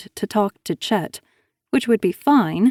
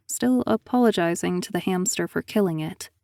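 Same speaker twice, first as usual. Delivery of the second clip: plain reading voice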